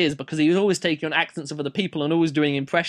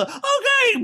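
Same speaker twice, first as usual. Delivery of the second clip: very high squeaky reply; hammed-up squeal of agreement; mock rescued admirer. Rescued person obeying the hero's order, high squeal.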